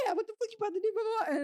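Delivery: squaring up over her; sister taunting. Retold or imitated. Sister looming over the girl, taunting.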